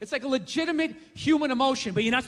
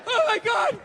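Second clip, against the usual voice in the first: mock despair; 9 semitones higher than usual. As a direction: wail as if starving before the shoot. Desperate dieter.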